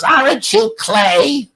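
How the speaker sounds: put-on high, slow elderly lady's question; old lady. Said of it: "some lady goes".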